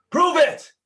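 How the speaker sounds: high, loud yell from the back; heckler's shout. Retold or imitated. Somebody in the background challenging the manager, shouted heckle. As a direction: call out a challenge across the room. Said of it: "somebody else in the background goes".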